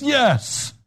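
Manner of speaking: put-on the kid shouting back at his teacher; child's defiant yes. Child answers teacher, retold.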